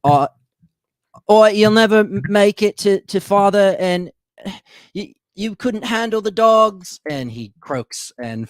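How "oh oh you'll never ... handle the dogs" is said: high, loud last-breath voice; high-pitched dying villain, halting between phrases; dying Zazu. Acted. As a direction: gasp out final words in a raised, put-on voice. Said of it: "Zazu's last breath, he, he whispers".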